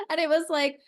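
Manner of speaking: usual speaking voice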